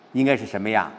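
ordinary talk, nothing marked

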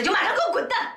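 very high-pitched furious order; angry ultimatum. Acted. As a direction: throw them out with your voice. 婆婆威胁赶人. (尖声怒喝)

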